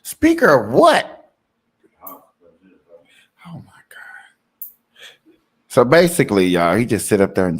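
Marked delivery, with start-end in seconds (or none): 0.0-1.3 high, loud, slow disbelief; stunned, dragging out the question; incredulous. Real, not acted. Reacting to the Wikipedia read. 2.0-5.3 breathless whispered shock. Real, not acted. Shocked reaction.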